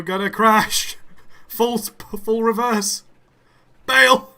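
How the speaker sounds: loud, high panicked yelling; panicked shout. Real, not acted. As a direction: yell the alarm, laughing. Train about to crash.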